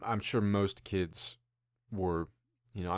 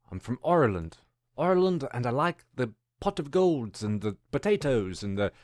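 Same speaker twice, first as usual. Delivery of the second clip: hammed-up, slipping Irish accent; badly faked Irish brogue; attempted Irish accent; 2 semitones higher, 3 dB louder than usual. Shown as a demonstration. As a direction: play at being Irish, not quite landing it. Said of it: "I can do an Irish accent".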